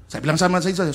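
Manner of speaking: usual speaking voice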